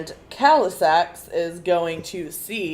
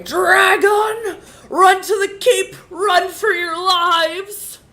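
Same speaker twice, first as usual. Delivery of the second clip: high panicked shout; screaming warning; 14 semitones higher, 10 dB louder, slower than usual. Acted. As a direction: yell a frantic warning to flee. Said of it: "and scream".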